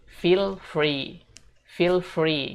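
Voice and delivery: repeat-after-me "feel free"; pronunciation model. Shown as a demonstration. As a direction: stretch "feel free" for learners to copy. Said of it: "teman-teman bisa ikutin aku".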